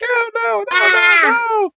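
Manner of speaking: high, hammed-up cry of panic; mock panic. Retelling the cartoon, she squeals in mock panic. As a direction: squeal it like a cartoon nurse going under. Nurse Joy swept into water.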